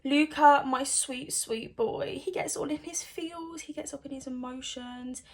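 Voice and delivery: soft, tender gushing; soft doting voice. Real, not acted. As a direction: coo over him like a sweetheart. Doting over Luca.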